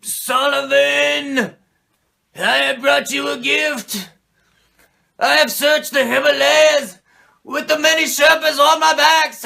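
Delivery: hammed-up ancient mentor proclaiming; grody old man voice. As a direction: intone like a mystic on a video screen. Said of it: "He's like".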